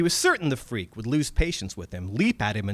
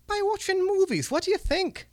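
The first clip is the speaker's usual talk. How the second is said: much higher dry Scots goblin retort; sarcastic goblin. Acted. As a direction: toss it back as obvious. Red cap retort.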